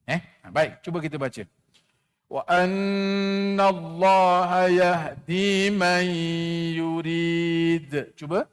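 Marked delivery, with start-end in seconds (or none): 2.3-8.1 slow chanted tajwid recitation; Quran recitation. Shown as a demonstration. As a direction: chant it slowly like a Quran teacher. Leads class Quran recitation. (bacaan Quran berlagu perlahan)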